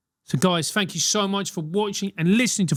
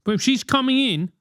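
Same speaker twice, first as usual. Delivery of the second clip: normal talking voice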